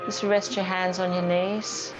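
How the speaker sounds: normal talking voice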